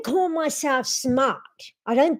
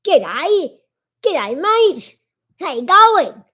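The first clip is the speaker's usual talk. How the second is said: louder, higher mock Australian greeting; mock Aussie; 3 semitones higher, 5 dB louder than usual. Greeting the viewers in a playful Australian accent. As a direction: ham up an Aussie g'day.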